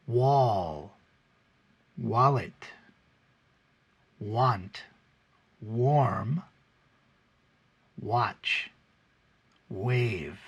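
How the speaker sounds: slow clear W words; W word list. Shown as a demonstration. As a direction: read each W word carefully. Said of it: "that is how we make the W sound".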